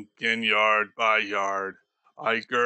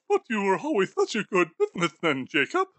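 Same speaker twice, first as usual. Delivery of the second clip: put-on higher, fast, fawning old miser; a nervous old man rushing out praise; hurried Scrooge; 9 semitones higher, much faster than usual. Scrooge flatters Marley.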